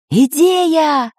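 high, stretched cry of discovery; excited exclamation. A narrator's reading. Geographer jumps up with a plan, excited exclamation. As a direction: burst out with a sudden brainwave. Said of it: "воскликнул географ".

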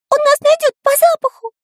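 very high, squeaky panic; Карандаш near tears. A narrator's reading. Small friend fears being sniffed out, near tears. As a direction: squeal it like a frightened little character. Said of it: "чуть не плакал Карандаш".

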